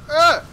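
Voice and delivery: loud, high yelp cutting in; startled yelp. Real, not acted. Gamer dodging danger mid-sentence, loud startled yelp. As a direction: break off with a sudden cry.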